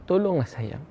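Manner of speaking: a mild impression of a weeping man's plea; retold tearful plea. Weeping sinner asks God for help, gently imitated pleading. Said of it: "Orang tu menangis macam budak-budak".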